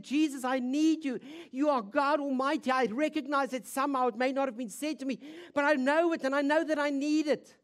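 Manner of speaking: high, loud, fervent pleading; desperate plea. Acted. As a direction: pour out the need urgently. Voices the broken woman's plea.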